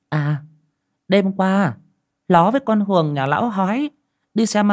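wife Dương telling her husband she's off to market, high voice; old wife voice. Acted. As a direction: pitch up as the busy wife heading to market.

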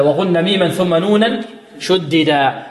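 normal talking voice

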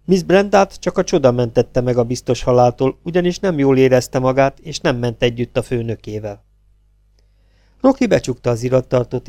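usual speaking voice

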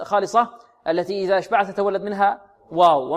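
ordinary talk

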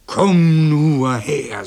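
loud, very slow mooing words; threatening cow. A narrator's reading. Cow Mairos daring the boy closer, bellowing. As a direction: stretch it into a bellowed challenge. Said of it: "sagte Mairos".

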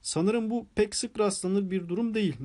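plain reading voice

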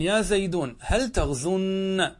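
drawn out, stress on هل; teacher spelling out يا زيدون هل تغزن slowly; slow model sentence. Shown as a demonstration. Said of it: "إذن".

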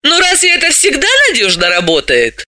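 high-pitched question with a smirk; sceptical, amused challenge; wry, teasing question. A narrator's reading. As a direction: ask it mockingly, pitched well up. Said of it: "с усмешкой спросила".

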